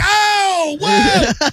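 high, drawn-out holler, far above normal pitch; mock excited whoop. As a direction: re-enact a wild, high-pitched cheer. Acting out his own popping yell.

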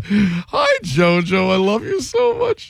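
high, gushing fan squeal; sing-song lovey falsetto greeting; imitating Jacob Two Times. Host imitating the caller he expected, gushing falsetto. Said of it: "I expected a".

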